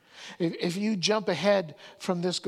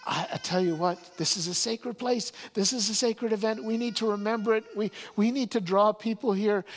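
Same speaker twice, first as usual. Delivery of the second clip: higher, rushed excitement; eager Peter; 6 semitones higher, 4 dB louder than usual. Retold or imitated. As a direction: blurt it out, carried away. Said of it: "Peter runs up to Jesus and says".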